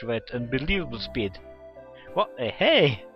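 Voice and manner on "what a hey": loud, high startled call; acted cry of protest; startled shout. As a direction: shout after someone dashing away. Acted shout after fleeing Kotori.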